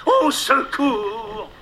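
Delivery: high slow drawn-out cries for help; cry for help. Acted. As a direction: wail for help, fading away. Hook fleeing the crocodile.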